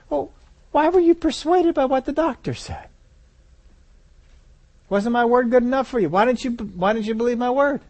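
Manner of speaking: high-pitched, incredulous appeal as Jesus; Jesus questioning. Acted. Jesus asks the believer about doubting his word, high puzzled questioning. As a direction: ask it high, in puzzled disappointment.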